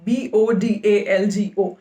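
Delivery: slow, letter-by-letter "B O D A L G O"; spelled out. Shown as a demonstration. Presenter giving viewers a website's spelling, "B O D A L G O" spelled out slowly. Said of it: "मैं spelling बता रही हूँ".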